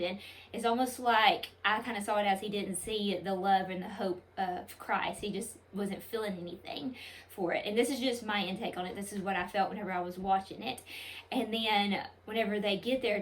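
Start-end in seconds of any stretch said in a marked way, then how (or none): none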